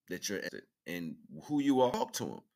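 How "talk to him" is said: usual speaking voice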